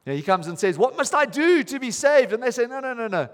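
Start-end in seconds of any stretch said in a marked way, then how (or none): 0.8-2.3 much higher and louder, pleading question; urgent plea. Retold or imitated. Jailer's plea retold. 2.7-3.3 eager correction. Retold or imitated. Apostles correcting the jailer.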